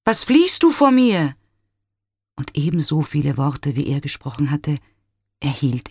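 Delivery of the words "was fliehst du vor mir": high, loud puzzled call. A narrator's reading. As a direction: shout after someone unseen.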